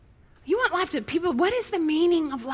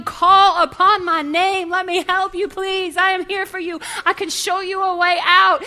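pleading God; 3 semitones higher, 6 dB louder than usual. Pretend. God begging from heaven for his children, loud earnest plea. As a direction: beg them to call on you.

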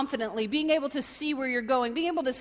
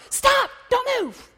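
high, loud, drawn-out warning yell; urgent shout; 11 semitones higher, 7 dB louder, much slower than usual. Acted. Parent seeing a car coming, urgent warning shout. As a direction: shout to halt a child in danger. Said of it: "I shout".

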